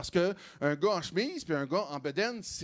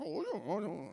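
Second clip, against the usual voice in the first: softer and softer oh's, trailing off; fading mock moan; 2 semitones lower, 9 dB quieter than usual. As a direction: let the oh's die away to nothing. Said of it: "Je fais".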